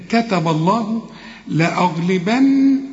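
"لأغلبن" with the final n held nasally, slow; nasal ghunna. Shown as a demonstration. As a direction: hum the nun of "لأغلبن" through the nose. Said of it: "النون أهي لازم تغنها".

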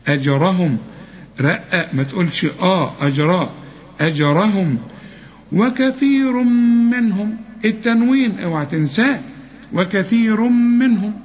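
ordinary talk, nothing marked

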